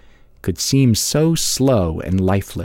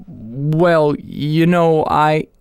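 stuttering; 5 semitones higher, much slower than usual. Acted. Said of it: "He stuttered".

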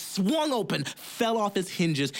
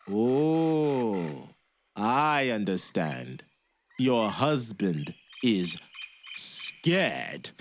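much lower, slow sneer; mocking giant; 7 semitones lower, much slower than usual. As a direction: sneer at the husband. Giant mocks woman.

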